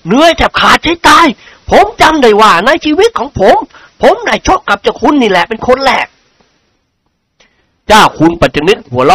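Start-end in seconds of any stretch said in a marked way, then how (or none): 0.1-6.1 lightly voiced rueful nobleman; high, complaining character voice; worn-out lord character. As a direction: play a lord grumbling after a fight, pitch raised. Lord admits exhaustion.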